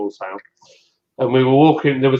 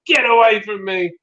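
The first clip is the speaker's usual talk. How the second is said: high-pitched imitation of an angry woman; retold indignant rebuff, pitched up; upset rebuff; 7 semitones higher than usual. Stranger pushing away the man who grabbed her, mock indignant. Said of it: "she did not take it well at all".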